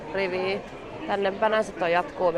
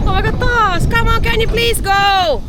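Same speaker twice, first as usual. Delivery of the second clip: much higher, louder, slow exasperated yell; exasperated shout; 13 dB louder, slower than usual. Real, not acted. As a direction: yell at them to hurry. Yelling at slow rider again. (kova turhautunut huuto)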